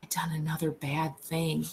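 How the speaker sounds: a scared, breathy whisper; frightened whisper. A narrator's reading. Lennie whispers over the dead woman in fright. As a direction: whisper it in fright, barely audible. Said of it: "he whispered in fright".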